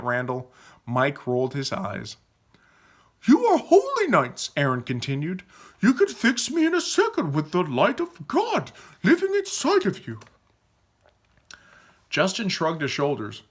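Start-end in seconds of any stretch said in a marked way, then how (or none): 3.3-4.5 very high, loud proclamation; excited insistence. Acted. Aaron insists, awestruck. 5.8-10.2 high-pitched, excited character voice; fervent Aaron. Acted.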